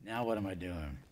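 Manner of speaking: talking with tongue out; tongue sticking out. Real, not acted. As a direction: say it with your tongue stuck out. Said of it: "I have my tongue sticking out at you".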